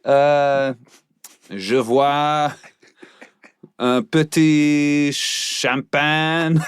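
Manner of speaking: put-on high, slow drunk French cop; French accent. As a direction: savor it like a tipsy Frenchman. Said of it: "be a French inebriated police officer".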